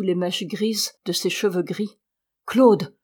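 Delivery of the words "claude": short, louder cry of recognition; startled exclamation. A narrator's reading. The table sees the newcomer, startled exclamation. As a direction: call out the name in surprise. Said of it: "s'écria-t-on autour de la table".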